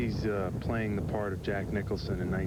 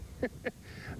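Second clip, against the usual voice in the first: high breathless laugh; 8 dB quieter than usual. Real, not acted. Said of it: "Sorry, I'm not crying".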